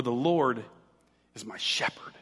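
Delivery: pause, then "shepherd" weighted in a near-whisper; low, hushed line landing slowly on "shepherd"; stress on SHEPHERD. Shown as a demonstration. Preacher showing another emphasis of the psalm, stress on SHEPHERD. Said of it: "what if I emphasize a different word".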